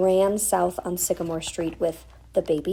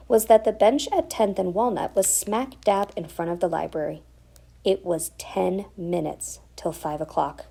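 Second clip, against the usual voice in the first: ordinary delivery, nothing marked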